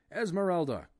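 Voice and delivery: high, loud urgent call; shaking the fainted woman, shouting; loud cry. A narrator's reading. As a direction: call her name sharply. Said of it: "he cried again".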